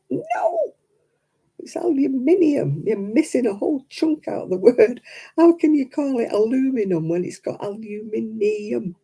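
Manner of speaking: high, exasperated insistence on aluminium; indignant correction. Retold or imitated. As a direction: correct them, outraged and high. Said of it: "I'm like".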